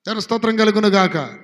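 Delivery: shouted praise to God; ringing Praise the Lord; loud praise. Acted. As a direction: call out praise to the church. Praise exclamation.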